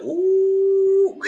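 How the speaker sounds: high squealing ooh into laughter; mock ooh and laugh. Reacting to the drama with a high ooh and laugh. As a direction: coo then giggle.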